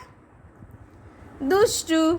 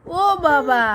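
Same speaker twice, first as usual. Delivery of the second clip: ordinary talk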